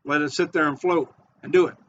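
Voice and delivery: normal talking voice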